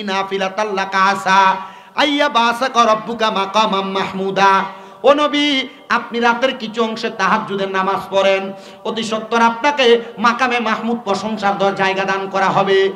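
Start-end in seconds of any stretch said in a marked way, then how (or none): none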